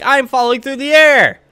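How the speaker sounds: high, loud mock cry; Pinch. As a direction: wail it out like a falling cartoon character. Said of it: "Pinch is just like".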